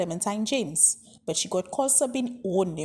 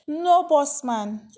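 firm refusal; 6 semitones higher, 6 dB louder, much slower than usual. A narrator's reading. Homeless man declines the ride.

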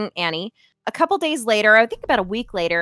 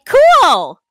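higher, louder imitated cheery reply; feigned enthusiasm; 8 semitones higher, 8 dB louder than usual. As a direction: play up polite cluelessness on one word. Retells her clueless upbeat reply.

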